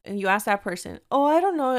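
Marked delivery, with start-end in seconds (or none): 1.1-1.8 clueless stranger. Retold or imitated. Imitates next stranger, equally unhelpful.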